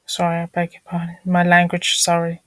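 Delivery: ordinary delivery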